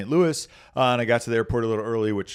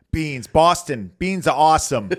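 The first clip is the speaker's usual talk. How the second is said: higher, louder try at a thick Boston accent; attempted Boston accent; 6 semitones higher, 8 dB louder than usual. Shown as a demonstration. Speaker shows his co-host the accent, hammed-up Boston. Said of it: "thick, like, full-blown Mark Wahlberg Boston accent".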